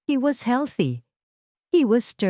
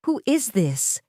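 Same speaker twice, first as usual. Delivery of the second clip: ordinary delivery, nothing marked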